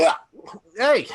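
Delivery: loud, high cry when grabbed; startled yell. Acted. Daniel grabbed by the collar, loud startled yelp. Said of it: "He goes".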